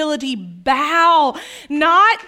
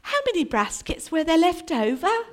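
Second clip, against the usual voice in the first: put-on soft, stagy English accent; hammed-up English accent; mock English accent; 7 dB quieter than usual. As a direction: ask it like an English Bible-movie actor. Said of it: "didn't say it in an English accent".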